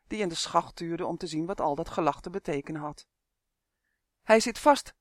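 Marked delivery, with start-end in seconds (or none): 4.2-4.9 louder, higher elf call; acted shout of word to the top. A narrator's reading. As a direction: call up to someone overhead. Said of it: "riep Uf".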